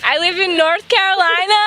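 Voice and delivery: high, bright put-on Southern American voice; American accent. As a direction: sound like a proud North Carolina local. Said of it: "What is your American accent?".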